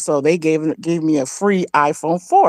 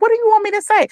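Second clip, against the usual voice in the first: high, frustrated disbelief; exasperated high question; 3 dB louder, much faster than usual. Retold or imitated. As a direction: voice shoots way up, fed up. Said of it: "I'm like".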